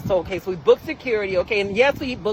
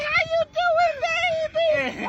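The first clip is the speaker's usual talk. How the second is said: put-on very high, sing-song "baby"; high sweet greeting; much slower than usual. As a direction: coo back at them playfully. Greeting the girls.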